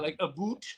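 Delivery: "boot" said with a long oo, as a mock Canadian "about". Podcaster quotes how Americans think Canadians talk, "a boot" for "about". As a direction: say "a boot" quietly for "about", with an oo vowel. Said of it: "they think we talk like that".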